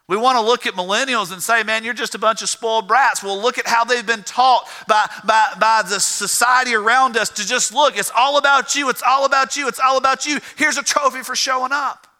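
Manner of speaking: high, insistent pulpit delivery; impassioned preaching. Real, not acted. Preacher telling church how millennials were taught, impassioned preaching.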